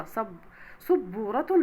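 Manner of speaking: slow صبورة, leaning on the double b; doubled b. Shown as a demonstration. Teacher showing shadda, صبورة with a held b. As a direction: break صبورة after the first b, "sab-būratun". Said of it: "حرف الباء".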